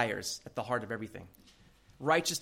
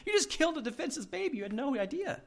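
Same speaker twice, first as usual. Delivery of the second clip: high, fast indignant reproach; mock telling-off; 10 semitones higher, 5 dB quieter, faster than usual. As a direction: rattle off a hammed-up scolding. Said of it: "give him a piece of my mind".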